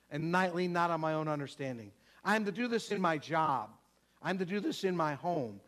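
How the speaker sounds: ordinary talk, nothing marked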